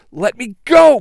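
loud, high straining yell; struggling against restraints. Acted. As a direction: yell while pinned down. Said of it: "pin the Ultra Rangers down".

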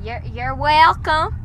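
bad Southern accent. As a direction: badly fake a Southern accent. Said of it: "That's the worst Southern accent".

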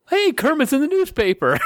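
high puppet voice through laughter; laughing Muppet voice. Retold or imitated. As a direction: squeak it out while laughing.

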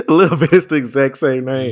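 loud, high-pitched laughing protest; laughing disbelief. Real, not acted. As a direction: crack up while loudly disputing it. Mocks co-host's hedge, laughing.

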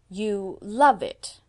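slow, loud, leaning hard on love; emphatic. A narrator's reading. Old lady insisting to Anne, slow loud emphasis on love. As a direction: press every word of "You love it" slowly. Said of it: "said Miss Patty with emphasis".

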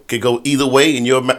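ordinary talk, nothing marked

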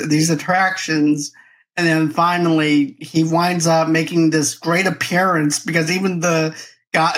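usual speaking voice

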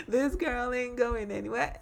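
said through real laughter, high; giggling while repeating the title; laughing. Real, not acted.

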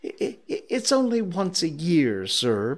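high, stammering plea; pleading. Acted. Late clerk excuses himself to his boss, pleading. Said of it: "pleaded Bob".

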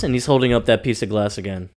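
usual speaking voice

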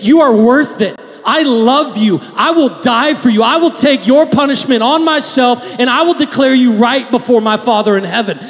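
loud, high impassioned declaration; fervent voice of Jesus; voicing Jesus, declaring. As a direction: proclaim it as Christ's vow. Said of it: "he made a statement".

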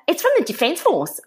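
high-pitched imitation of mum in a state; hysterical mum. Mum on the phone reporting who wrote, mock hysterics. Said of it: "my mum's in hysterics on the phone".

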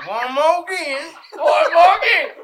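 shouting through laughter; laughing shout. Real, not acted. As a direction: yell it while cracking up. Laughing fit over the slug.

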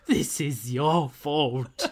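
drawn-out, overacted accusing cry; hammed-up blame, dragged out slowly; mock accusation. Widow's accusation mockingly acted out.

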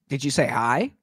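usual speaking voice

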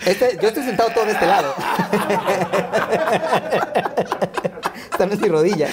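high, giggling words through real laughter; laughing hard. Real, not acted. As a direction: crack up while you talk. Laughing fit while describing pose.

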